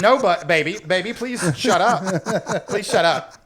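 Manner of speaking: slow, laughing plea to hush; laughing pleading. Retold or imitated. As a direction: beg her through laughter to stop. Hushing daughter in panic.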